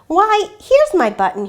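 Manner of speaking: high, loud cry of delight; excited cry. Acted. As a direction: burst out happily at finding it. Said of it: "he cried".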